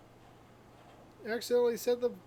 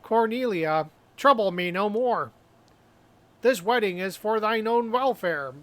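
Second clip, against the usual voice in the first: hammy stage actor. Acted. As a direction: overact it like a drama student on stage. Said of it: "I have studied drama".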